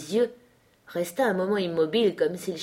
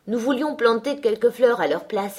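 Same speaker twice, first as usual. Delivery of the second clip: higher, louder protest; mild exclamation; 5 semitones higher, 5 dB louder than usual. A narrator's reading. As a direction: cry out in defence. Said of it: "s'écria-t-elle".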